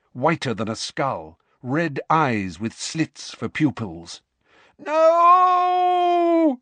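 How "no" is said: scream. Acted. Waking from nightmare, screaming.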